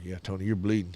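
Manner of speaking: soft coach reply. Retold or imitated. Coach softly confirming to the bleeding boy, low. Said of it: "And I was like".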